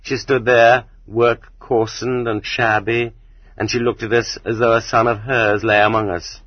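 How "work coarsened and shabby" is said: usual speaking voice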